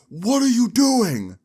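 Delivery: high, loud, slow shout; calling out. Retold or imitated. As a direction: bellow it across the zoo. Zoo employee calls out sharply.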